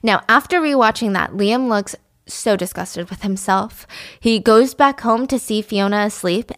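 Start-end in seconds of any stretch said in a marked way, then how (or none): none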